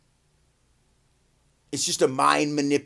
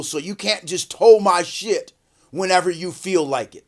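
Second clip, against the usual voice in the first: usual speaking voice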